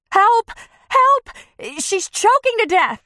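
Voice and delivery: high, loud desperate scream; screaming for help. Acted. Woman screams for help as friend chokes, desperate. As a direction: shriek for help across the room.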